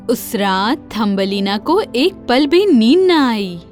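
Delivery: usual speaking voice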